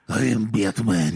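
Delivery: slow, lightly voiced Batman line; a hint of the gravelly hero; Russian nerd playing Batman. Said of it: "He was probably like".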